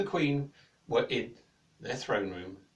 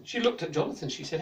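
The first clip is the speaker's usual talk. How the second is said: ordinary delivery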